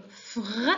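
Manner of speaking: "fr" blended as a single sound; one blended sound. Shown as a demonstration. Teacher reminding pupils of a blend, "fr" said as one sound. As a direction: sound out "fr" without separating f from r. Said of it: "c'est un seul son".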